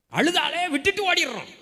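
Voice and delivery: high, loud pulpit exclamation; loud rebuke. Real, not acted. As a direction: cry out the accusation to the congregation. Preacher exclaims rebuke at congregation.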